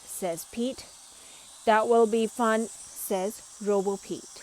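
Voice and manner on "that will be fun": robot voice. A narrator's reading.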